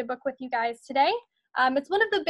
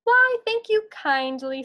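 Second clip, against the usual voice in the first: put-on a light, higher, slow old-farmer voice; folksy farmer; 7 semitones higher, 3 dB louder than usual. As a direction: thank the kids as a kindly farmer, slowly. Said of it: "said Farmer Ben".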